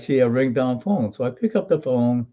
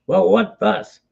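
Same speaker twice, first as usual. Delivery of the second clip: impatient repeat; 7 semitones higher, slower than usual. Retold or imitated. Operator repeating herself to the confused soldier, impatient. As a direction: push the question back, losing patience. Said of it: "her reply was".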